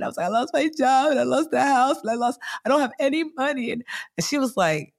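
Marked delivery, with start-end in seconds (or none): 0.3-3.7 high, hammed-up tearful wail; imitated crying. Confessing losses to best friend, high imitated crying. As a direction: list your losses in a mock sob. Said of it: "I just started crying".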